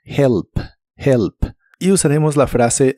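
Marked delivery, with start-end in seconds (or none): 0.0-1.3 "Help" with a soft Spanish j start; "help" breathed from the throat. Shown as a demonstration. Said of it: "como una J suave".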